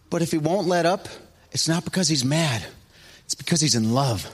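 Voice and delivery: ordinary delivery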